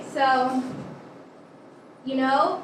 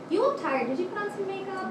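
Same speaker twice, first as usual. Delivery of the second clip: phone error message voice; 6 semitones higher, 5 dB quieter, faster than usual. Retold or imitated. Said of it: "their error message will say".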